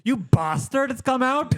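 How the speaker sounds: high, loud mock shout; mock yell. Neighbour shouting at doorbell pranksters, high loud yell. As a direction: yell it at the hidden kids. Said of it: "he yelled the one time".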